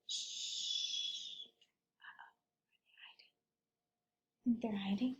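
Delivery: breathy secret whisper; hushed whisper. Acted. Reading to children, whispers while the kids hide. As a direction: whisper it like hiding.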